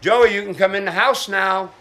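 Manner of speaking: high call across the yard. Real, not acted. Father calling his son into the house, calling out. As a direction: call him in from outside.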